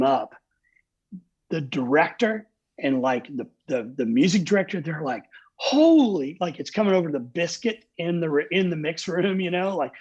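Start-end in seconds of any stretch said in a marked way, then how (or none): 5.6-6.4 stunned exclamation. Retold or imitated. Stunned crew in mix room.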